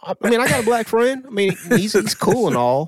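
high-pitched mock protest as the driver; imitating flustered driver. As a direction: play a flustered man excusing himself, pitch easing down. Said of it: "He was like".

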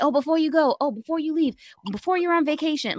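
mock the last-minute asks; mimicking pushy coworkers. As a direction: coworkers piling on work before vacation. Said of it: "people keep piling on your plate".

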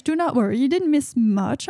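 usual speaking voice

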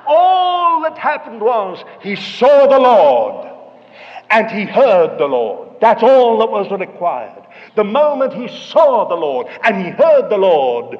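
loud, emphatic sermon delivery; full-force preaching. Real, not acted. Preacher telling how Peter walked on water, forceful preaching. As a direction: drive every phrase home to the congregation.

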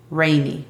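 "Rainy" with the R made tongue drawn back to the palate; "Rainy", pronounced REI-ni with an untrilled English R. Shown as a demonstration. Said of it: "colocando la lengua en la parte posterior del paladar".

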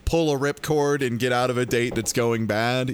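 usual speaking voice

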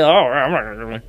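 a lightly voiced snooty brush-off; mocking the player. Mocking Pittman's refusal in a lightly put-on voice. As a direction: toss it off like a smug player. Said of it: "You can't be going around with".

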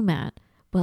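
normal talking voice

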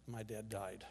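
soft, low, heavy with loss; quiet grief. Retold or imitated. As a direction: let it drop almost to nothing. Grief at father's deathbed.

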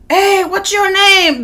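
high, drawn-out call; viral video voice. Retold or imitated. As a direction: imitate a funny video's dubbed voice. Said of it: "Those videos".

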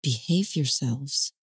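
low-key, slow and soft, but firm; softly drawn-out, firm murmur. A narrator's reading. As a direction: murmur it slowly as a firm warning. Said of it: "I hear her murmur".

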